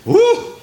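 high, sudden "woo"; relieved whoop. Real, not acted. Preacher to congregation, glad to live under grace, relieved whoop.

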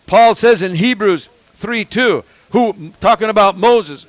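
ordinary talk

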